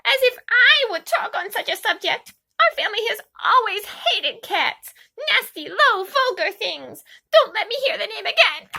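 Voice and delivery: angry mouse crying out at Alice; furious mouse. A narrator's reading. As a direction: shriek back in disgust. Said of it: "cried the mouse, who was trembling".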